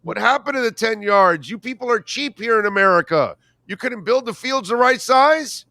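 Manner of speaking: mock outrage, high-pitched and incredulous; indignant Canadian fan. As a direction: complain loudly in a rising, exasperated whine. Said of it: "you're standing around going".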